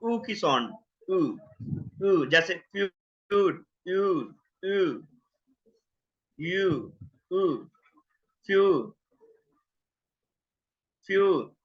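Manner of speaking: "ऊ" and "फ्यू" repeated, slow and careful; eu diphthong. Shown as a demonstration. Teacher working through the hard eu sound for students. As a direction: drill "यू" as in feud, slowly. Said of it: "ये थोड़ा सा मुश्किल है".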